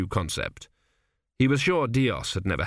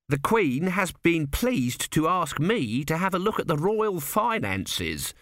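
higher voice, drawn out at the end; pleased son; 7 semitones higher, slower than usual. A narrator's reading. Son tells his father of royal favour, pleased. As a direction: announce a royal honour with pleasure.

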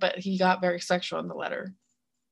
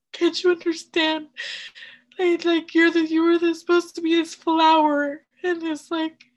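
tearful; 10 semitones higher, 3 dB louder than usual. Real, not acted. Mother yelling, told through tears.

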